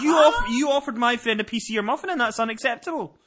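high, indignant mock scolding; mock indignation. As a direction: play the outraged son scolding mum. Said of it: "acting outraged at mum".

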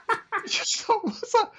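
words breaking into laughter, pitched high; giggling at a terrible accent; laughing incredulously. Real, not acted. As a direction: laugh through it in disbelief. Laughing at Stephanie's bad accent.

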